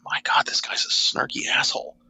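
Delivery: hushed whisper, amused at the book; whispered aside. Retold or imitated. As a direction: whisper it like a guilty pleasure. Retold thought while reading.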